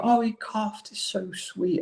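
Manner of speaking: high cooing parent; doting baby talk. Retold or imitated. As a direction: gush over a baby. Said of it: "raise your voice a little bit higher".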